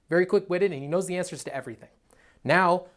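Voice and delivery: ordinary talk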